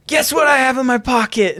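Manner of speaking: high teasing rocker shout. Retold or imitated. Rock star teasing the crowd, high shout. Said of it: "he's like".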